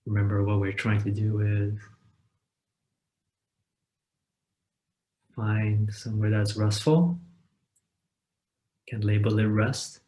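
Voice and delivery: calm meditation guide with long, unhurried pauses; guided meditation. Acted. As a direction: guide listeners gently into rest, leaving space after each phrase.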